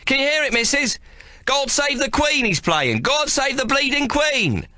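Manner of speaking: a street lad crowing over the noise; boy shouting. Acted. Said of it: "one of the boys shouts over the din".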